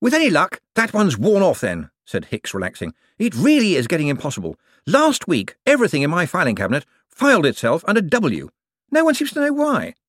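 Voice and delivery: high, eager, bright-voiced wizard; a jumpy official covering for himself. A narrator's reading. Hicks complaining about spells.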